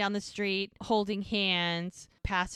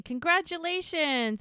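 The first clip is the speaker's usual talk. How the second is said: high-pitched imitation of a group cheer; celebrating friends; 8 semitones higher, 4 dB louder than usual. Sewing circle toasting the bride, excited cheer. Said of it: "all holding up champagne glasses saying".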